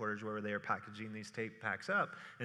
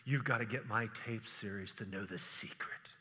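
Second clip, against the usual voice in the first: hushed conspiratorial sales voice; false prophet sales pitch; 3 semitones lower than usual. Retold or imitated. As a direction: whisper it like a huckster selling fear. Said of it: "if I say".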